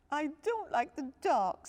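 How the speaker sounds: high, small frightened cub voice; Little Bear. A narrator's reading. Little cub telling dad he's scared. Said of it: "said Little Bear".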